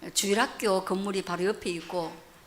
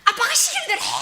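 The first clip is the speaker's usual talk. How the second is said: high-pitched mock dad yell; hammed-up scolding; imitated shouting; 13 semitones higher, 7 dB louder than usual. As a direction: yell the order like a bossy father, for laughs. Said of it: "그러면서 막 소리 지르는데".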